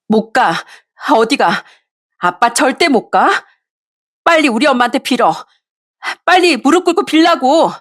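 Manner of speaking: rising to a high yell; desperate demand. Acted. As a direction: daughter stopping her father from leaving. Daughter blocking father.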